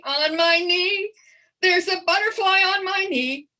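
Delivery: ordinary talk, nothing marked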